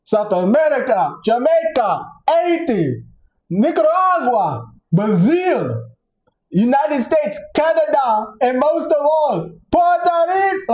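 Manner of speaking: high, proud roll call of countries; emphatic roll call. Retold or imitated. Listing off all those amazing places, high and proud.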